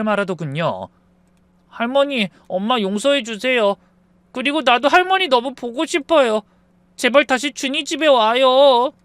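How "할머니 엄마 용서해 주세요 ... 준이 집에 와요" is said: put-on pleading child. Child begging grandma to return.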